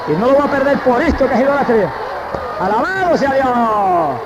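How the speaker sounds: high, rising testimony into praise; fervent high pulpit cry; impassioned preaching. Real, not acted. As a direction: declare it with conviction, then draw out the praise.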